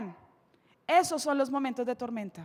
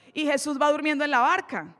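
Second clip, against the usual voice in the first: usual speaking voice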